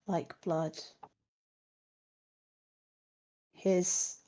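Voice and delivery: soft, halting corpse voice with long gaps; faint and slow, groping for each detail; dead woman answering. Acted. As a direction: give it out word by word, as if from beyond the grave. Dead Miletta describes her murderer.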